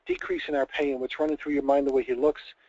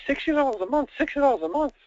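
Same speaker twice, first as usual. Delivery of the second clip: much higher, louder, delighted repeat; relieved excitement; 12 semitones higher, 5 dB louder than usual. Acted. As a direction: bounce the amount up in relief. Wife repeating amount, relieved.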